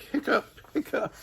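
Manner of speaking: high squeaky hiccup noises; monster hiccups. Acted. Monster hiccupping in the basement, high squeaky hiccups. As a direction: hiccup it out like a little creature.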